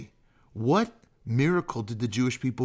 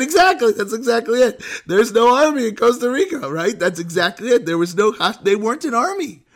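high, loud, exclaimed agreement; animated, raised-voice retelling of a comeback; excited retort; 8 semitones higher, 6 dB louder, faster than usual. Retold or imitated. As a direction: pounce on the point, pitched way up. Excited retold reply to student.

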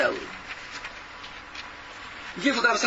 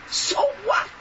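"what" thrown up high in scorn; a defiant retort to the listeners; scoffing so what; 9 semitones higher than usual. Acted. Preacher dismissing an objection.

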